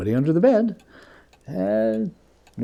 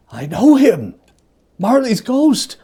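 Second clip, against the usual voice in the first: louder, much higher cry of recognition; 10 semitones higher, 6 dB louder than usual. Acted. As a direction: cry out at the ghost, rising on its name. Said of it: "as though it cried".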